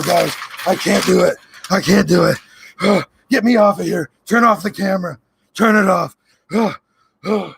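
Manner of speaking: lightly voiced ranting conspiracy host; a hint of a frantic Alex Jones; Alex Jones impression. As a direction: play a paranoid talk-show ranter losing it. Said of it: "was that a decent Alex Jones".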